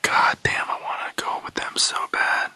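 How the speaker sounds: hushed, longing whisper; whispered craving. Retold or imitated. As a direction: whisper the temptation to yourself. Craving drugs, inner voice.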